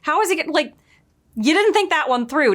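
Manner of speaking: sarcastic gamer talking back to the game; incredulous mockery. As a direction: jeer at her dumb move. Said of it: "And I'm like".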